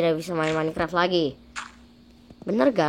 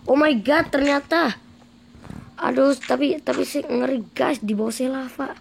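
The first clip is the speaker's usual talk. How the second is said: scared exclamation; 6 semitones higher, 3 dB louder than usual. Acted. Sees lava beneath him.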